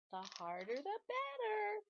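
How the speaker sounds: very high gleeful pigtail. Acted. Pigtail egging Paul on, very high and gleeful. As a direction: relish it gleefully.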